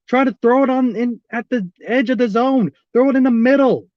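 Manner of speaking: ordinary delivery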